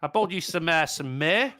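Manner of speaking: Australian bloke greeting Mary at the manger; Australian wise man greeting Mary; Aussie bushman accent. Pretend. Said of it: "gruff woman in the world".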